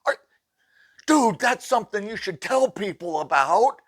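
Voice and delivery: high, loud spooked voice, calming down; rattled and needing a break; freaked out. Retold or imitated. Sheetrocker spooked by the ghost news: high, loud freaked-out voice. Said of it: "And he goes".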